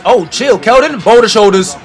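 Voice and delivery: ordinary talk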